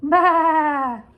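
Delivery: call-and-response sheep baa. Shown as a demonstration. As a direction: bleat along with the kids. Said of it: "What does a sheep say".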